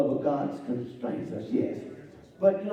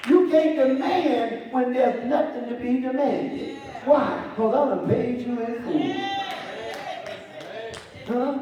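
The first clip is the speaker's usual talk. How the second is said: preaching; 8 semitones higher than usual. Acted. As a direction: preach it hard and rhythmic. Preacher at full force.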